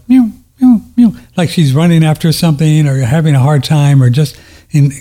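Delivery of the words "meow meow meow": high far-off whimpering meows; imitated dream whimper. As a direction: mimic a dog crying in her sleep. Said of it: "going like".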